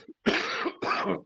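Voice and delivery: dry cough from a cold; cough, clearing the throat. Real, not acted. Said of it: "je suis un peu enrhumé".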